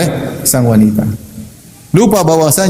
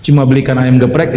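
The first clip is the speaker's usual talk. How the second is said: ordinary delivery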